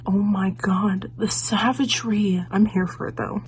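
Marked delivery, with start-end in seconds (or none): 0.1-2.4 whispering. Real, not acted. Awed reaction to a savage line.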